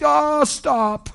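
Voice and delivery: preacher scolding her with a loud brush-off. Retold or imitated. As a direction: wave it away loudly. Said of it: "And I'm like".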